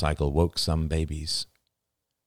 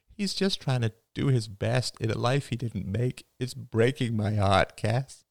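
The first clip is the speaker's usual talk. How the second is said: tearful; 6 semitones higher than usual. A narrator's reading. Father tells his wife it breaks his heart, mildly tearful. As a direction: let the tear into the words. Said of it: "A tear fell from his eye".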